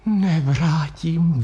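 slow, breathy exhale of joy; a girl overwhelmed with happy tears; breathed out. A narrator's reading. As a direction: let the word out on a breath.

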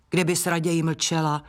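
ordinary delivery, nothing marked

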